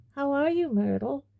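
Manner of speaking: high, fake-cheery greeting; falsely bright. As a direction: overdo the friendly brightness. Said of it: "in a falsely bright voice".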